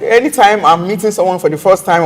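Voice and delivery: ordinary delivery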